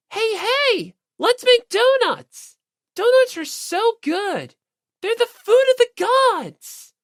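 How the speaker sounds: put-on high, loud, gushing girl's voice; bubbly Asahina. Asahina begging the others for donuts, high excited squeal. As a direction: squeal it like donuts are heaven.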